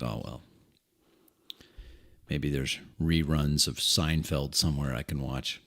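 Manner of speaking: low, soft mock disappointment; disappointed quote. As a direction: sigh it out, let down.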